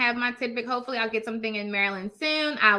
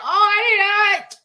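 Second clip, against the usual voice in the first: mock whiny denial; 7 semitones higher, 6 dB louder, much slower than usual. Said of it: "Then you be looking crazy, like".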